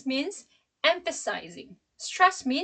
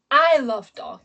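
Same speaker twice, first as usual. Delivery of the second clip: "I" loud with a swooping pitch; stress on I; 9 dB louder than usual. Shown as a demonstration. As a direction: insist on "I" against the others. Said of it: "I in this sentence".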